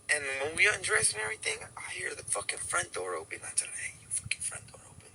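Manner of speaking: hushed retold whisper; secretive storytelling whisper. Hiding from husband, whispering story.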